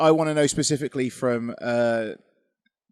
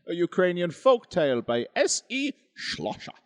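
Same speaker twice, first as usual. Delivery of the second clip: hammed-up German accent, pitched higher; 6 semitones higher than usual. Announcing the ghost story's author, mock German accent. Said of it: "I'm doing a German accent for it".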